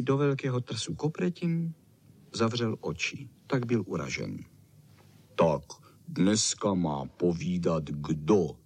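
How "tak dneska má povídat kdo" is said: low, slow muffled rumble; mumbling big dog. A narrator's reading. Bernardín asks who tells today's story, low slow mumble. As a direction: mumble it deep and unhurried. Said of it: "Huhlal Bernardín".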